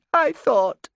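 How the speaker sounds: high, breaking on the repeat; voice cracking. Acted. Erasmus breaking down as he confesses. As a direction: let the voice crack with tears. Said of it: "His voice cracked".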